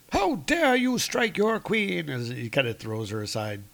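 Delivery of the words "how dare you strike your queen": outraged queen. Acted. Sif outraged at Thor.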